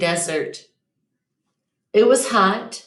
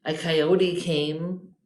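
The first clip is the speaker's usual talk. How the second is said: ordinary delivery, nothing marked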